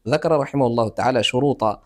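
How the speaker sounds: ordinary talk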